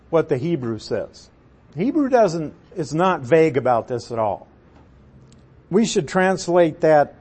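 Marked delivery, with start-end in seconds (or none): none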